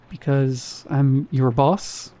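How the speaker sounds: slow, hesitant statement that turns up into a question on "boss". A narrator's reading. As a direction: BOSS lifted into an unsure question. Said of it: "He said it like a question".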